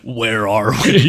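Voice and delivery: put-on slow, lost old robot voice; senile Prime. Riffing on the movie, slow senile voice. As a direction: drift off like you forgot where you are. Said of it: "Senile Prime".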